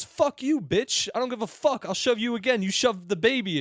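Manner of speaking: high-pitched, unshouted imitation of rage; mock angry retort. Man to woman on the bus, mock angry. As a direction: spit it back high without real volume.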